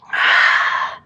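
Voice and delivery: long hard breath out on exertion; forceful exhale. Real, not acted. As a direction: blow the air out sharply with effort. Exhaling through exercise reps.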